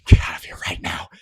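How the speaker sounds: forceful stage whisper; urgent whisper. Acted. Harlan orders Othello to hide, fierce whisper. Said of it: "curses silently".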